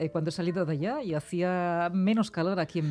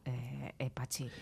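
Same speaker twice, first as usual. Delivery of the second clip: whispered aside; 5 semitones lower, 12 dB quieter, slower than usual. Real, not acted. Host whispering to her guest after admitting she talks fast, sheepish whisper. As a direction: whisper it, softer, embarrassed.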